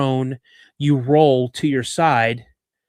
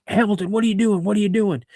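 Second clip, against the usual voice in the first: lightly acted sergeant's challenge, pitched up; demanding instructor; 7 semitones higher, faster than usual. Instructor confronting a trainee kneeling on the road, demanding. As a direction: imitate an instructor demanding answers in a higher voice.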